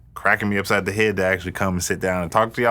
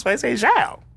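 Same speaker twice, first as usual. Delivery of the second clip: high joking emphasis; 14 semitones higher than usual. Acted. Joking King James voice.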